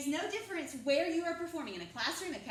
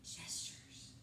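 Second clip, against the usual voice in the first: whisper; 11 dB quieter than usual. Shown as a demonstration. Teacher echoes answer in whisper.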